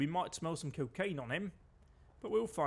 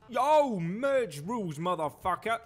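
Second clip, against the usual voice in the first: loud, high yell at another driver; angry yell; 6 semitones higher, 8 dB louder, slower than usual. Real, not acted. Driver yelling at a car cutting in, loud and angry. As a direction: bark it out at the road hog.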